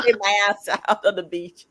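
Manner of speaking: amused, breaking into a chuckle; real laughter midway through the line; laughing. Real, not acted. Laughing at own remark about swimming.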